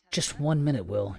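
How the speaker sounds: ordinary delivery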